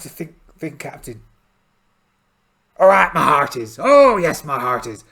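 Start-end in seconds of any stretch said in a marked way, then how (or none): 2.8-3.8 pirate captain voice. Acted. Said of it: "I think I've got the voice back". 3.8-5.0 pirate captain voice. Acted. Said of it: "I think I've got the voice back".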